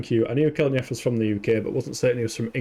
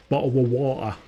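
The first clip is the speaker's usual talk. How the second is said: "bottle of water" in a glottal British accent; regional British accent. Shown as a demonstration. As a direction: drop the Ts in "bottle of water" for a mock English accent. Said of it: "all the different bottle of waters".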